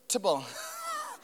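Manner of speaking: short high burst of laughter. Real, not acted. Preacher laughing at the people's excuse, high burst. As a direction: laugh it off quickly.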